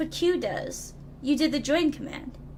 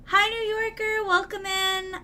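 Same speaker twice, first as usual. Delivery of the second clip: high, held-up greeting tone; singsong welcome; 7 semitones higher than usual. Real, not acted. Streamer welcoming a newcomer in high singsong. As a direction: lift the whole welcome high.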